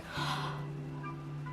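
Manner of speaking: sharp unvoiced shocked gasp. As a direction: gasp as if horrified. Imitating shocked people's reaction.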